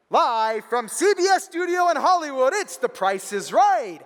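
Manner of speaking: big, high, loud show-opening call; booming imitation of a studio announcer; game show announcer. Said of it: "I hear the very famous sound".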